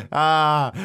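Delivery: normal talking voice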